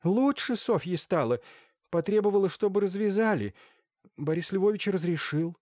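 high-pitched anxious witness; Irina Lukinishna. A narrator's reading. Irina explains to the doctor about Sofia, high-pitched character voice. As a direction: pitch it well up for the woman's account.

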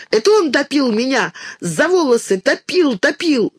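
put-on high, furious childish accusation. A narrator's reading. Girl accuses the boy of drowning her, furious high cry. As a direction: hurl the blame in a rage. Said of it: "с неожиданной яростью".

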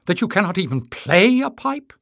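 high, loud outraged question; incredulous cry. A narrator's reading. Tyrrell challenging the unmusical companions, incredulous cry. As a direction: pitch climbing in disbelief. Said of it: "cried Tyrrell".

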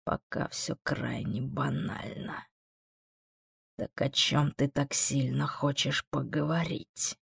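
slow, soft, condescending demon; hushed, leisurely demon voice; unruffled demon. Acted. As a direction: speak slowly and quietly, unimpressed. Voices the demon Amon in dialogue.

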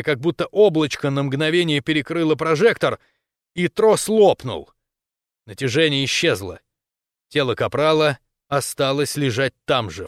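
plain reading voice

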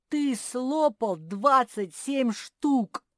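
slow, high, loud accusation; loud declaration. A narrator's reading. Bear cub accusing squirrel of gorging, loud declaration. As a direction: declare it loudly, drawing out each word. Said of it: "заявил Фил".

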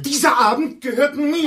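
screamed very high and loud; shrill shout. Acted. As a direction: scream it, this evening is mine. Claims the evening angrily.

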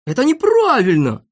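high, indignant cry; outraged high-pitched protest; protesting shout. Acted. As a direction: shout out an objection. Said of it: "закричал тут Сиропчик".

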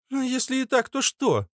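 put-on high, cheeky girlish comeback; caustic girl. Girl guard answers back, caustic and high. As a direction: answer defiantly, pitched very high. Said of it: "Едкая девчонка".

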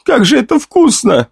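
put-on high, thrilled child's voice; boy overjoyed by an apple; delighted boy. A narrator's reading. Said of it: "почти кричал".

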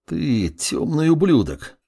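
slow, strained groan; groaning in pain. Acted. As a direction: grunt the words out, hurt. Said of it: "прокряхтел он".

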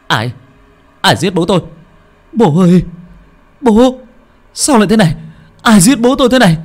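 anguished screaming. A narrator's reading. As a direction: scream out in grief, voice far up high. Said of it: "nó gào thét". (gào thét đau đớn)

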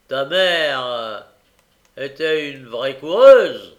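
pitié moqueuse qui finit en cri; feinte compassion. A narrator's reading. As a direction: fausse pitié qui enfonce le clou. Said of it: "d'un ton de feinte compassion". (Noé feint la pitié puis lâche « coureuse » très fort)

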